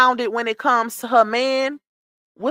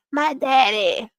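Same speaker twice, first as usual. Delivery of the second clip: imitating him; 4 dB quieter, slower than usual. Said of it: "y'all know I be imitating people good now".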